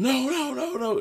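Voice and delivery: high-pitched retold "no" cries; mock yelling. Guard trying to stop a driver, mock yelling. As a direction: imitate a frantic traffic guard, pitch shooting up. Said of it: "And then he starts yelling".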